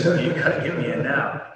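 retold loud demand. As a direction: insist loudly, a mock-urgent demand. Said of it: "I said".

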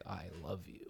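serious love you. Retold or imitated. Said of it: "you can tell by the tone".

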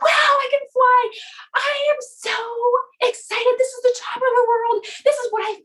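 put-on excited baby bird. Baby bird flying over trees and mountains, overjoyed. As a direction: marvel aloud in a high, elated voice. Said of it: "it's getting so excited".

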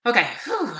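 breathless sigh of relief; catching her breath. Real, not acted. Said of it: "why am I out of breath".

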